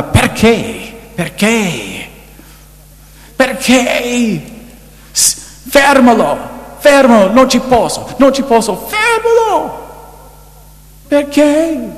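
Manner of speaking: high, desperate wailing pleas; retold anguished screams. Preacher retells a dying woman's screams, anguished high wail. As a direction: cry out like a dying woman begging it to stop. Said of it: "lei gridava".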